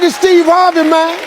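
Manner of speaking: high, exuberant self-announcement; showy cheer. Retold or imitated. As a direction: announce yourself with exaggerated pep. Answers with showy new cheer.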